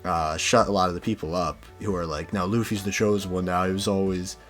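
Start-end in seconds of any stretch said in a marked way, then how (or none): none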